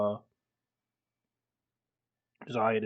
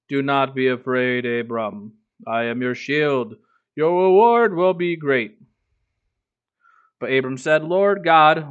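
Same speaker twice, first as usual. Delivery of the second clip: a lightly heightened Bible reading; scripture reading; 6 dB louder than usual. Acted. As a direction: read the passage aloud like a lector, a bit above your chat voice. Reads scripture aloud to chat.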